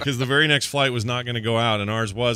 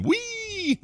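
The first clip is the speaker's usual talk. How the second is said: mock gleeful yell. Reading a chat member's joke, mock gleeful yell. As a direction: squeal it like a thrill rider. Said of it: "says he'd be yelling".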